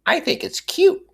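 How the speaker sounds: very high, gushing over the puppy; enthusiastic. A narrator's reading. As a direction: squeal it out with delight.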